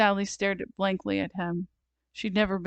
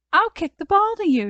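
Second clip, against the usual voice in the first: put-on high, loud excited child; eager little girl; 11 semitones higher, 7 dB louder than usual. A narrator's reading. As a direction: pipe up eagerly like a small girl. Sally eagerly plans the game.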